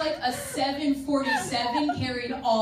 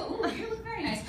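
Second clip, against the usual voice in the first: higher, softer flirtatious ooh; high soft admiring compliment; flirty coo; 9 semitones higher, 5 dB quieter than usual. Acted. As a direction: flirt playfully with a guy in the crowd. Flirting with an audience member.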